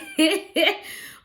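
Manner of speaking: bright burst of laughter; real laugh. Real, not acted. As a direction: laugh at your own excuse. Laughs at own excuse.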